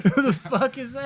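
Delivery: high, loud, laughing question; laughing while talking. Real, not acted. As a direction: laugh it out in disbelief. Laughing at a mixed-up name.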